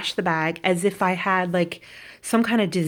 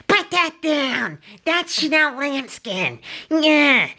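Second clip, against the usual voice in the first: higher, slow and haughty put-on voice; snooty salesman; 8 semitones higher, 4 dB louder, slower than usual. Mocking the rude Chanel salesman scolding her, high and slow. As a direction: look down your nose and drag each word. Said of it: "this guy screamed at me".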